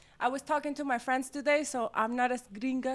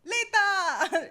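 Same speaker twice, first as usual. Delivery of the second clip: excited; 4 semitones higher than usual. Acted. Co-host announces herself.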